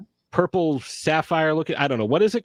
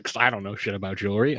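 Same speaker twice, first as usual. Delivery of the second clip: ordinary talk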